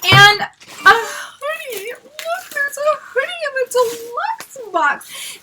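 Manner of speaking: slow, high, near-crying squeal; overwhelmed delight. Real, not acted. Fan finding a hoodie in the deluxe box, overwhelmed delight. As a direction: draw it out high, as if about to cry.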